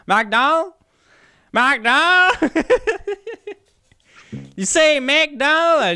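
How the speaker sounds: high, loud, mock old Texan asking. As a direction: ham up a baffled old Texan, slow. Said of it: "un vieux Texan dans le fond qui a soixante et onze ans".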